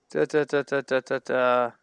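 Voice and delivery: low, monotone string of da-da-da; idle da-da filler. Real, not acted. Host searching on the fly, idly da-da-ing. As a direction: fill time with toneless da-das while searching. Filling time while searching online.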